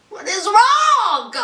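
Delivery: high, slow, drawn-out disbelief; exasperated. Real, not acted. Host despairing at Caitlyn, exasperated. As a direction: stretch it out in sheer exasperation.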